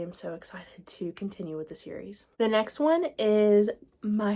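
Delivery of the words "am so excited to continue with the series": soft whispered excitement. Real, not acted. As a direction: whisper it like a secret thrill. Excited aside about the series.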